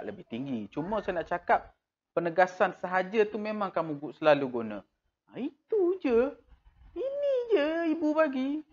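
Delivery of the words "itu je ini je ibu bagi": put-on much higher whiny child voice; child complaining. Child grumbling to mother about the money. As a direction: whine like a child shortchanged.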